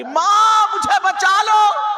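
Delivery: high shouted cry for mother; girl crying out to her mother, shouted; screamed plea. Acted. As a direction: wail it out, desperate.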